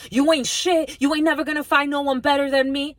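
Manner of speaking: high, loud mocking taunt; mock nasty partner insult. A spiteful partner mocking their significant other, loud high taunt.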